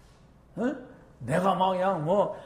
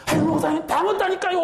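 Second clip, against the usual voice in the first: high, loud, laughing protest; a congregant insisting she did, with a laugh; whiny protest; 10 semitones higher, 4 dB louder than usual. Retold or imitated. A churchgoer protesting to the pastor, mock whiny and high. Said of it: "이래요".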